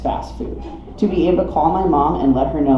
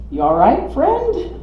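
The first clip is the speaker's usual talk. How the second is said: cautious projecting; 12 semitones higher, slower than usual. Acted. Driver to stranded hiker from the car window, cautious projecting. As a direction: call out warily, pitch climbing on the last word. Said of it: "cautiously projects".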